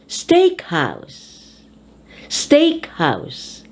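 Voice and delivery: "Steak" high in "Steakhouse", "house" dropping; compound stress. Shown as a demonstration. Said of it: "you emphasize the first word".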